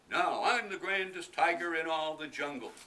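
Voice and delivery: boasting tiger. Acted. Tiger walking off in the shoes, loud proud boast. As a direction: crow it like a vain tiger.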